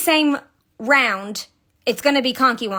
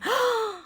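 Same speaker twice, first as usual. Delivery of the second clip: delighted gasp; 12 semitones higher, 3 dB quieter than usual. Real, not acted. Delighted at finished toy.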